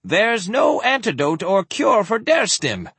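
high, insistent protest; alarmed denial. Acted. Frightened doctor denies having cure.

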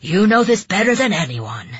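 loud stern reproach; scolding. A narrator's reading. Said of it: "Yoshi scolded".

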